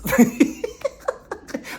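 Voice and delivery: high, breathy laugh at his own joke; high giggle bursting out; burst of laughter. Real, not acted. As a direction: crack up laughing.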